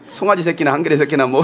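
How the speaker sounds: usual speaking voice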